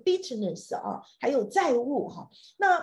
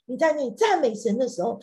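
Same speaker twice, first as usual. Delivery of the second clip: usual speaking voice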